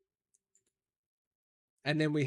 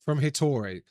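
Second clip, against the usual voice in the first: usual speaking voice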